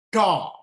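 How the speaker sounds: Ta with the tongue curled back; curled tongue heavy consonants. Shown as a demonstration. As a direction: retroflex Ta, tongue curled against the palate. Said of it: "the tongue is a little bit curled".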